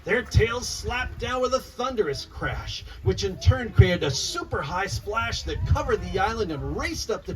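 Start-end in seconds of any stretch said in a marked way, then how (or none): none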